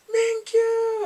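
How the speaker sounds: loud, high, drawn-out shout; grand entrance call. Retold or imitated. Viewer acts out his arrival from above, grand high call.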